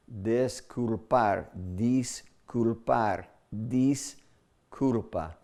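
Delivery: disculpar, disculpar, disculpa spelled out very slowly, syllable by syllable; drawn-out word demo. Shown as a demonstration. Preacher dissecting the word for the congregation, very slow and deliberate. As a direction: stretch each syllable of disculpar like a teacher dissecting it. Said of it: "disculpar no es la palabra cuando tenemos culpa".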